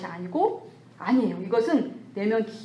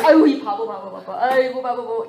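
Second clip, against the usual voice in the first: mock self-scorn; 6 dB louder than usual. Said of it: "이러잖아요".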